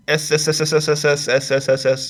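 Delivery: long snake hiss on s. Acted. Stretching a title into a hiss.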